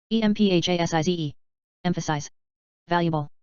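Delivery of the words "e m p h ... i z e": letters rattled off fast; quick spelling. Shown as a demonstration. Teacher spelling the word fast for the class. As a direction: run through the letters briskly. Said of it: "spelling drill".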